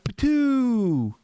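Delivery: high mock spit noise; spitting sound. Garfield spitting a grape into the air, mock spit. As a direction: spit it out like a cartoon.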